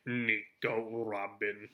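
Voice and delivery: "Nico Robin" said very slowly as a lightly voiced dark, mysterious line; deep and dark. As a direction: give the name "Nico Robin" an ominous, drawn-out weight, only a hint of menace. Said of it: "they say it so deep and dark".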